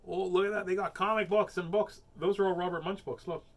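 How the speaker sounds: usual speaking voice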